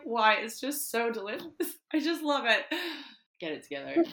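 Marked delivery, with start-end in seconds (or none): 0.0-2.7 imitating tearful gushing. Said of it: "The other day I cried over chocolate".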